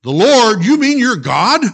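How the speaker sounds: high, loud disbelief; astonished shout. Acted. Jack learning the voice is God, astonished shout. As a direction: shout in amazement.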